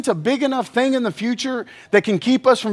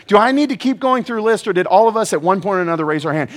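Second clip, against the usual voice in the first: normal talking voice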